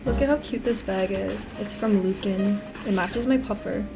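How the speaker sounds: normal talking voice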